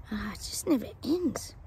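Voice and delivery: breathy whispered aside. Real, not acted. Psychic sighing to viewers about the royals, whispered. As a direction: whisper it to yourself, weary.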